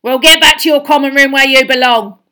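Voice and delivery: very loud, high angry command; furious caretaker's snap; snapped order. A narrator's reading. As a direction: bark him off back where he came from. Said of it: "snapped Filch".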